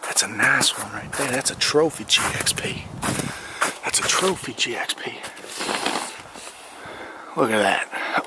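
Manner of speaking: hushed, slow hunter's whisper; mock hunter whisper. Stalking a car like wild game, mock hunter whisper. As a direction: breathe it like you're stalking game. Sneaking up on cars, "Pontiac hunting.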